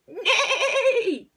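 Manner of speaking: very high, loud drawn-out whinny; all-out neigh. A narrator's reading. Goose pretends to be a horse, loud high whinny. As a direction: neigh with all your might. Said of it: "with all her might".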